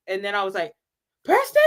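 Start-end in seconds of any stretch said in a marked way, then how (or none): 1.2-1.6 excited surprised call. Retold or imitated. Fan spotting him, surprised call. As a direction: call out in delighted recognition.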